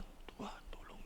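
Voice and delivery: breathy whispered plea; a plea that fades out into a whisper; whispered prayer. Retold or imitated. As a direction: let the prayer die to a whisper.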